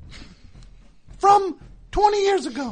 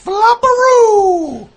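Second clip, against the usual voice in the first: long and loud; drawn-out loud verdict; 3 semitones higher, 10 dB louder, much slower than usual. Acted. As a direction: stretch it out like a game show buzzer. Host mock verdict.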